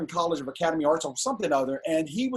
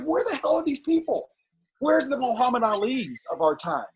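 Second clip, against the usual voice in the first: high, frustrated rhetorical cry; exasperated outcry; 6 semitones higher than usual. Retold or imitated. Fed up with Shaq on Fox, exasperated. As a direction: demand it in disbelief. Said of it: "I'm like".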